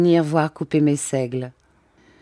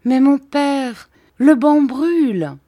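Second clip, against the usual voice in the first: put-on much higher, louder, slow child's cry; a small girl protesting to her father; little girl crying out; 10 semitones higher, 6 dB louder, slower than usual. A narrator's reading. Madeleine protests to her father in a high child's cry. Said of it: "s'écria Madeleine".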